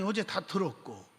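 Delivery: usual speaking voice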